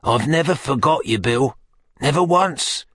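put-on high, placating old fence; submissive. A narrator's reading. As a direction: plead loyalty meekly, pitched high.

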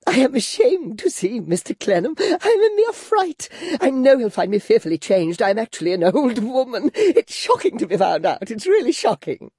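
put-on high, girlish, fluttering chatter; coquettish Flora. Flora fishing for compliments from Clennam, high and girlish. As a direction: play the flustered former belle.

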